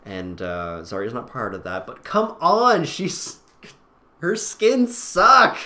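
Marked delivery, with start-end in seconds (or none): 2.0-5.6 loud, high-pitched complaint; exasperated protest. Real, not acted. Podcaster complains about Zarya's skins, exasperated and loud. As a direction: burst out in indignant protest.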